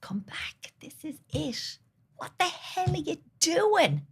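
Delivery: high pleading whisper rising to a cry; imitating inner child. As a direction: voice a desperate little girl. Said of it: "was screaming at me".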